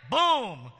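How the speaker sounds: loud high spoken boom; boom effect. Acted. Pastor adds a blast to the story, loud boom. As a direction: punch it out like an explosion. Sound effect after the scripture.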